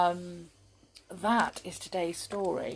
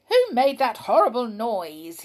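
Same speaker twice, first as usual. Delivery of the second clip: a mildly cross, louder demand; 4 semitones higher, 8 dB louder than usual. Acted. Binky waking up grumpy, mildly cross. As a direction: grumble it like a grumpy waker. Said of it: "he said crossly".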